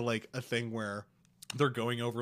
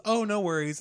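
higher, louder chirpy breeze; mock chirpy Megan; 9 semitones higher, 8 dB louder than usual. Retelling Megan's dismissive reply, chirpy. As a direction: brush it off brightly. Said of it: "Megan says".

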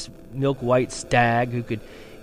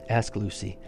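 ordinary delivery

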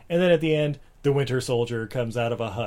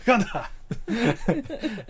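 laughing; 9 semitones higher, much slower than usual. Real, not acted. Mock correcting the title.

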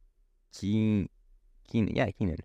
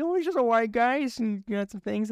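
mocking voice. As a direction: poke fun in a high voice.

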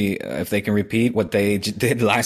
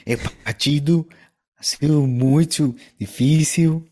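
put-on Portuguese accent; 5 semitones higher than usual. Portuguese-accent Spanish commentary impression.

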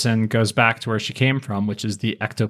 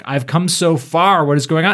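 a mock anguished cry, higher and louder; Savage despairing; 6 semitones higher, 5 dB louder than usual. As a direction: howl it out like a cornered brute. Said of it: "And he's like".

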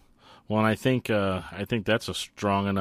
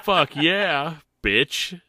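higher, louder mock victory shout; triumphant cheer; 8 semitones higher, 5 dB louder, much slower than usual. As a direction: celebrate yourself out loud. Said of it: "I was like".

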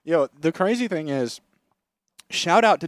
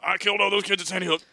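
Alex Jones impression; 6 semitones higher, faster than usual.

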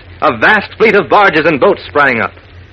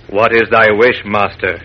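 deep, slow genie voice; lightly voiced mystic genie; 7 semitones lower than usual. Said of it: "asked Sidney Sniggleglass".

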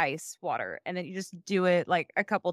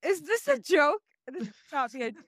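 high, tearful laughing; laugh-crying disbelief; 8 semitones higher than usual. Real, not acted. Asking co-hosts if the article is a joke, laughing through tears. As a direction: laugh so hard it nearly turns to crying.

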